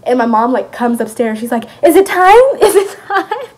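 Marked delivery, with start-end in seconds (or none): 1.8-3.5 very high, loud frantic question, half laughing; hammed-up panicky excitement; dramatic mom. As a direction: imitate an overexcited mother bursting in. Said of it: "my mom is dramatic".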